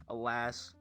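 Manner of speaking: normal talking voice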